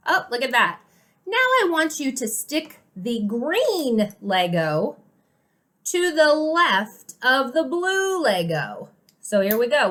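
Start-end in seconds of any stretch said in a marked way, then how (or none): 1.2-8.9 "left" stretched out slowly and stressed; slow, high, deliberate instruction leaning on "left"; emphasising "left". Shown as a demonstration. Parent teaching child left with Legos, slow emphatic "left". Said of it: "We're emphasizing left".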